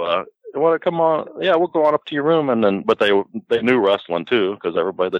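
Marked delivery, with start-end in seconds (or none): 0.5-2.5 a teasing, higher mock invitation; higher voiced girls' come-on. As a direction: pitch it up, lightly mimicking the girls. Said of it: "these girls go".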